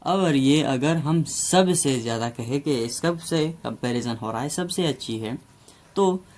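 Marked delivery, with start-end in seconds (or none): none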